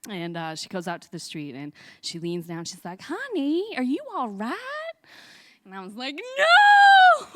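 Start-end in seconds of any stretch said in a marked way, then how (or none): 3.0-4.9 put-on high, gentle old lady's concern; kind old woman. 6.1-7.3 put-on high, loud wailing kid's no; crying shout. Lost child crying out.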